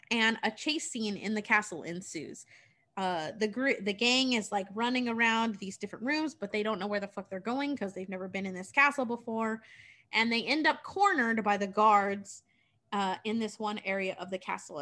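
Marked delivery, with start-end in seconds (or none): none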